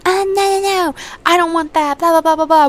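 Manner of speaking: high-pitched exaggerated complaining; mock protest. Kids fighting over a fortune game, mock protest.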